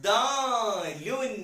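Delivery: put-on slow, clear model for children to copy; drawn-out letter name. Shown as a demonstration. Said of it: "അപ്പൊ ഈ അക്ഷരത്തിന്റെ പേരാണ്". (വളരെ സാവധാനം നീട്ടി ഉറക്കെ)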